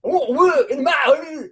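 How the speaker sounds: high, garbled British comic impression; mock a British accent, words mashed together. Eager British comic asking host for US work, mock British accent. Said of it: "that was an amazing British accent".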